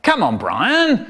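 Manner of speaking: dad losing patience slightly; mildly cross, drawn-out call; a bit cross. Acted. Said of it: "getting a bit cross".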